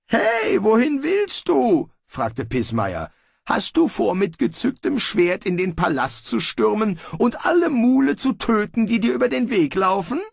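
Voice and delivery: high, fretful voice of a worrier; alarmed objection to Bane's plan; high anxious voice. Acted. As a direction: squeak out the protest. Pismeier objects.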